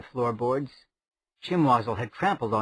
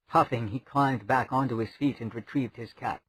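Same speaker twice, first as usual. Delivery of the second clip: plain reading voice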